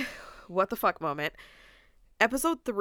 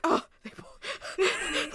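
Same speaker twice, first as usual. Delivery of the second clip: stunned; 11 semitones higher, much slower than usual. Retold or imitated. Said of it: "I was just like".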